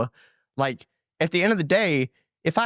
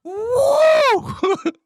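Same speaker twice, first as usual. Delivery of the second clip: a much higher, louder full-throated whoop shouted out; loud imitated howler whoop; 6 dB louder than usual. Asked to repeat the noise into the microphone, loud howler whoop. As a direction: belt the animal whoop into the mic. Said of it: "Can you do that one more time, right in the microphone?".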